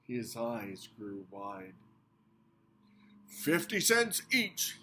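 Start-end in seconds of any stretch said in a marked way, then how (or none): 3.3-4.8 announcing. A narrator's reading. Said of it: "he announced".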